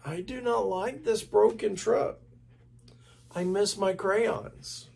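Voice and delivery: high, tearful monster voice; crying Grover. A narrator's reading. Grover answering Molly, high and tearful. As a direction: let it wobble with tears. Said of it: "cried Grover".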